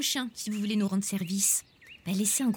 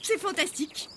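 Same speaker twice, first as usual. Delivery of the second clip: high, slow sun-basking sigh; blissful; 10 semitones higher, much slower than usual. Acted. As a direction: savor the spring sunshine. Gendarme ravie au soleil.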